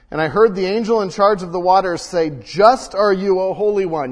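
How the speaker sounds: scripture read with force. Acted. Pastor reading Revelation to the church, loud and measured. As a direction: let the angel's words ring out.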